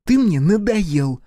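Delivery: high, loud and drawn out; irritated sister. A narrator's reading. Ранит tells brother off, irritated. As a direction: needle a younger brother, slowly.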